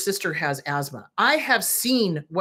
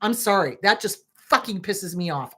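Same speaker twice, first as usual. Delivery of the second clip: usual speaking voice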